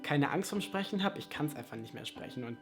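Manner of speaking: ordinary talk, nothing marked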